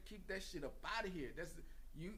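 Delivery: ordinary talk, nothing marked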